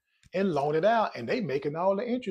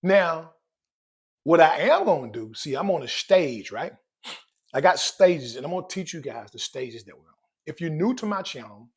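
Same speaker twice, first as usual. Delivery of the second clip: ordinary talk, nothing marked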